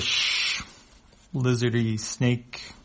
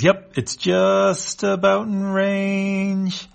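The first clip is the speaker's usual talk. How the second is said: slow alien voice; 10 semitones higher, 6 dB louder, much slower than usual. Acted.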